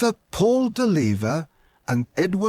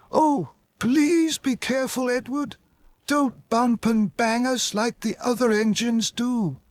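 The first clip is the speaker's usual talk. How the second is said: much higher pleading coach voice; fussy coaches; 9 semitones higher than usual. Acted. The coaches beg Edward to be careful, high timid plea.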